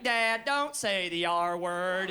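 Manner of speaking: low, slow robotic monotone; pissed-off robot daughter. Acted. Offended robot daughter correcting her dad, slow robotic monotone. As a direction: scold dad like an offended android, drawn out. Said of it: "she gets pissed off like".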